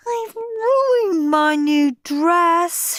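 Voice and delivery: high slow wailing shriek. A narrator's reading. Susan shrieks over her ruined dress, high and slow. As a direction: whine in dismay. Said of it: "shrieked Susan".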